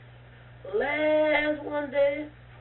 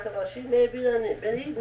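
ordinary delivery

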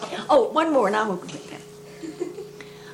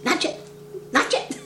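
guinea voice; 4 semitones higher, 5 dB quieter than usual. Retold or imitated. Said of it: "The old guinea".

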